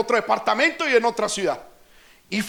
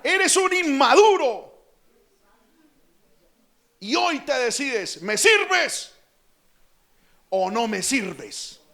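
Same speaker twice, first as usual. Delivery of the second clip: stern rebuke; 3 semitones higher, slower than usual. Retold or imitated. God confronting him, retold.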